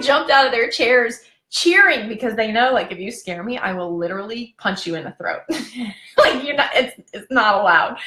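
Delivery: ordinary talk, nothing marked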